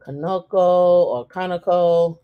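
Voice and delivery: Conoco said two ways, stress shifting between second and first syllable; two pronunciations. Shown as a demonstration. Offering viewers both versions of the company name, two pronunciations. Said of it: "Some people say Conoco".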